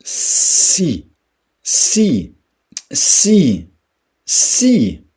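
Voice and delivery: si, si, si, si loud and high; sharp si. Shown as a demonstration. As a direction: shoot each si out high and loud. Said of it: "Si je prononce".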